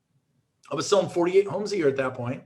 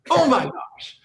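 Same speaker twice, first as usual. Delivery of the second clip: higher and louder burst of awe; excited exclamation; 6 semitones higher, 4 dB louder, slower than usual. Shown as a demonstration. Speaker to viewers, hyping Super Bowl day, excited. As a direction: let the wonder burst out. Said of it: "repeated softer".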